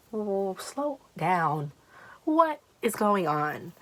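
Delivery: slow, drawn-out acted plea; slowing someone down. Storyteller telling her agitated friend to calm down, words drawn out slowly. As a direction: stretch out each word to rein in a friend.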